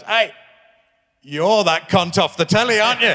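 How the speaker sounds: a hint of a Scouse fan shouting over; retold Scouser heckle. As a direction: call out like a stranger on the street. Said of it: "a proper Scouser came down".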